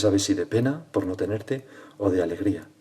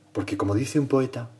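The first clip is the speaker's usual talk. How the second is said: normal talking voice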